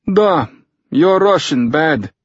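put-on high-pitched Russian-accented broken English. A narrator's reading. Fyodor criticizes Tucker's Russian, in Russian-accented broken English. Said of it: "in badly broken English".

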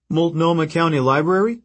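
high, surprised question; puzzled. Acted. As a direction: read the sign in disbelief. Frank reading sign, surprised.

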